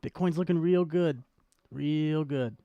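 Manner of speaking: ordinary talk, nothing marked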